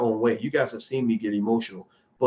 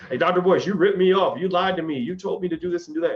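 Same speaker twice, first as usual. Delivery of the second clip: higher and louder, complaining accusation; mock angry client; 7 semitones higher, 3 dB louder than usual. Angry viewer who lost money accuses the host, mock complaint. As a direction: play the burned investor blaming him. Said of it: "lose a crazy amount of money and then get mad at me".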